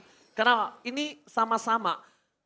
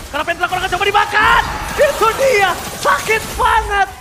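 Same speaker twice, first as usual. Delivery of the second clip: high, loud esports shout; hype shout; 9 semitones higher, 8 dB louder than usual. Real, not acted. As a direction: yell the kill call. Caster shouts kill call.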